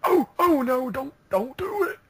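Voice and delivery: hammed-up cowering voice; mock panicked pleading. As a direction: plead in exaggerated terror, high and stretched out. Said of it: "He was like, ... threatened Laurinaitis".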